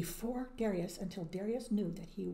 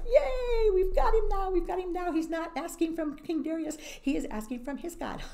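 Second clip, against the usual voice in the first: gleeful official; 11 semitones higher, 7 dB louder than usual. Acted. Official gloats to the king.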